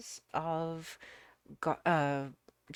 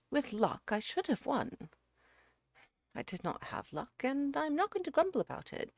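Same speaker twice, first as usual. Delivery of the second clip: higher, emphatic character dialogue; suitor owning his defeat; 9 semitones higher, 4 dB louder than usual. Acted. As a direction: take the blame with emphatic resolve. Narrator voices Jerry accepting defeat.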